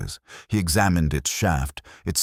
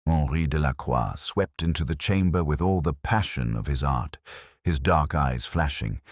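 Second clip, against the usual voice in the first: usual speaking voice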